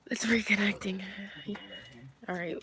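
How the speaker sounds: under the breath; whispering. Real, not acted. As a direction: whisper it to yourself. Waiting for the stream to reconnect.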